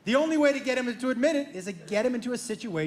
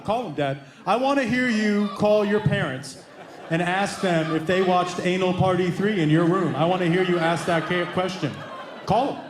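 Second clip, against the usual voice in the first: brother's low demand; 6 semitones lower than usual. Retold or imitated. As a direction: lean in and press him, unhurried. Brother voiced demanding the call.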